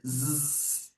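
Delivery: "Z" as a long, low buzz; sustained voiced "Z", buzzing; little bee buzz. Shown as a demonstration. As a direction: hold a soft zzz like a bee. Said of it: "da abelhinha".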